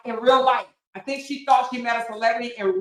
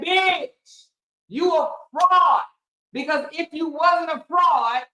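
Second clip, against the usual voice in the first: retold shouting; 3 semitones higher, slower than usual. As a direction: bark the dare at an online rival. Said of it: "And Miss Simone saying".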